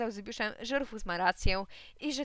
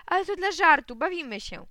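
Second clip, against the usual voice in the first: excited; 8 semitones higher, 9 dB louder than usual. Acted. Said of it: "zaczęła się śmiać".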